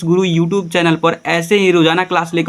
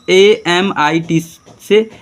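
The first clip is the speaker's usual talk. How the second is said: slow spelling; much slower than usual. Shown as a demonstration. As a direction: spell A M I T से very slowly, letter by letter. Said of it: "teacher spells slowly, then repeats". (धीरे-धीरे एक-एक अक्षर)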